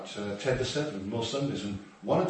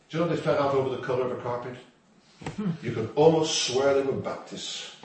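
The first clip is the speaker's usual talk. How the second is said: ordinary talk, nothing marked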